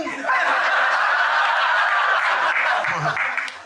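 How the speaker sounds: long high airy laugh; wheezing laugh. Real, not acted. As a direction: laugh breathlessly at your own joke. Comic's big laugh at own joke.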